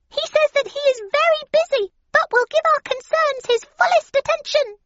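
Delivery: very high, loud little mouse voice; high-pitched squeaking rodent; squeaky mouse. A narrator's reading. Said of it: "squeaked the oldest of three mice".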